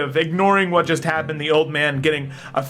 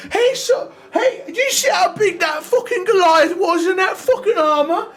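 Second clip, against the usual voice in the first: put-on a furious old drunk shouting across the tavern; angry old drunk; 13 semitones higher than usual. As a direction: rage like a sore loser who lost his bet. Drunk old man keeps ranting.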